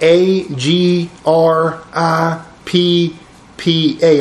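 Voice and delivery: slow, letter-by-letter spelling of the name; spelled out slowly. Shown as a demonstration. Teacher spelling the corrected name for students, slow and deliberate. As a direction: spell it out slowly so the class can write it down. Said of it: "So you might want to change that".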